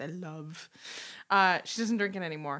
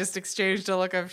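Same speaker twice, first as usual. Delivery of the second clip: normal talking voice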